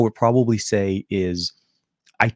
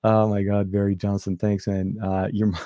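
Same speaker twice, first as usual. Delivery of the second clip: normal talking voice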